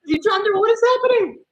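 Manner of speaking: very high, loud cry of disbelief; outraged shout. Real, not acted. Streamer yelling at Minnesota for dropping it, high outraged shout. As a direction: yell at the team for the drop.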